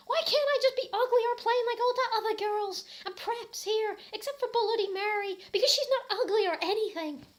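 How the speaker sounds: high, loud whiny yelling; hammed-up angry shout. Ebony yelling at Draco about the preps, high shriek. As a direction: shriek it like a furious goth teen. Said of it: "I shouted angrily".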